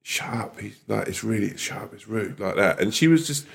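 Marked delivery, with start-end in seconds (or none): 0.0-2.4 a sharp, hushed telling-off; hushed whisper. Retold or imitated. Friend hushing the rude woman in the front row, whispered. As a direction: whisper it urgently, under your breath. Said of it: "whispering to her friend".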